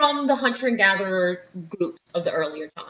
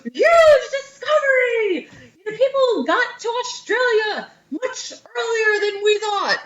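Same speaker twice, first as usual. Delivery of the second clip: high, drawn-out mock news headline; news announcement; 11 semitones higher than usual. Teacher imagining tonight's news, excited high mock announcer. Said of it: "they could be like".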